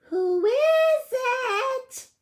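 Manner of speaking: put-on high, slow old granny voice; granny calling out. A narrator's reading. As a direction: call out like a frail grandmother. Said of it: "called out Granny".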